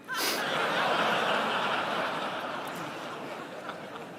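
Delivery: a long rising burst of real laughter; laughing in disbelief at the order. Real, not acted. Laughs recalling the guide's order.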